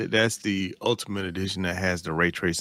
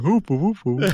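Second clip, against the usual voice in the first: mock yawn noise; 9 semitones higher, 5 dB louder than usual. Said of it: "You're like".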